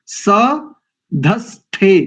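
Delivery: सधस्थे very slowly with aspirated थे; drawn out सधस्थे, ध and थ breathy; सधस्थे ending in थे, not ते; correct pronunciation. Shown as a demonstration. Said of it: "ते नहीं करेंगे".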